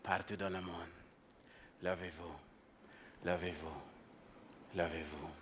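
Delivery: low, soft, spaced-out call to rise; hushed French invocation. Acted. Speaker summons the African people in French, hushed invocation. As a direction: intone it quietly, leaving long pauses.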